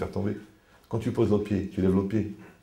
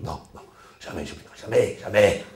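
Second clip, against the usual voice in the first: hushed mock refusal; 3 semitones lower than usual. Imitating parent refusing to forgive.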